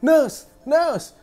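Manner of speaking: high, loud panicked call; patient screaming for the nurse; shouting for nurse. Retold or imitated. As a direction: yell for help. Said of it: "dia jerit".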